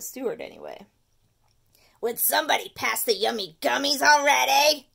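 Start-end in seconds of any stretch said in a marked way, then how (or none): 2.0-4.9 loud, pushy candy craver; demanding tooth. Acted. The tooth demanding peanut butter cups, loud. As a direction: bark for candy like a greedy monster.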